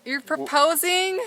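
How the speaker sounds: loud, slow, disbelieving gasp of a question; shocked. Real, not acted. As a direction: blurt it out, stunned. Wife reacts to kneeling husband.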